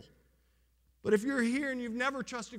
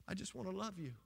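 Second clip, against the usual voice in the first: soft, tender, much softer promise; tender Jesus; 9 dB quieter, faster than usual. Acted. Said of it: "And he says".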